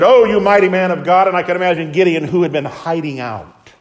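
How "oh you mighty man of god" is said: a hint of a grand, lofty angel; angel's greeting. Angel hailing hiding Gideon, lightly proclaimed. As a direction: hail him like a hero. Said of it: "the angel confronted him and said".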